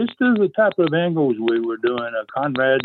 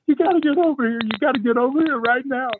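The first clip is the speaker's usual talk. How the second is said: high, urgent mimicked pleading; panicking wife; 11 semitones higher, faster than usual. Wife begging a friend to come over, panicking. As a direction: imitate a frantic caller in a high voice. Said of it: "she's, she's panicking".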